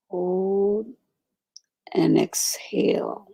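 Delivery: slow, hushed breathing guidance; guided meditation. Acted. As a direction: whisper them through each breath.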